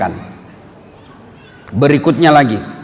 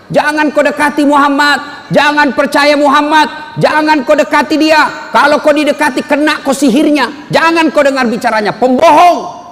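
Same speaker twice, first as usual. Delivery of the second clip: raised, higher voice of a market crier warning people; shouting blind man; 8 semitones higher than usual. Retold or imitated. Preacher voicing the blind man shouting in the market, raised.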